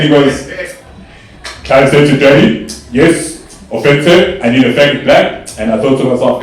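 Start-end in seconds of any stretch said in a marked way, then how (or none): 1.6-5.5 comic barked message, seal-like; seal-like Daddy. Retold or imitated. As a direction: imitate a loud seal-man. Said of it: "he sounded like a seal".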